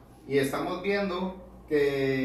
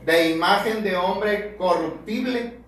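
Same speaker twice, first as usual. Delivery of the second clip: louder, higher preaching that builds, climbing to a peak on hermanos; fiery preaching; 4 semitones higher, 7 dB louder than usual. Acted. Preacher reading Romans to his brothers, preaching at full force. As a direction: thunder the verses at the congregation.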